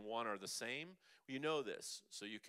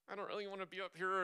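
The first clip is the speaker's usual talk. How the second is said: nervous imitation; 8 semitones higher, much faster than usual. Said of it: "people get really nervous and they're like".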